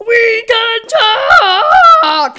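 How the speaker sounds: loud, slow, stretched monster yell; monster scream. Acted. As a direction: scream it as a zombie horde. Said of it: "screamed the zombies in unison".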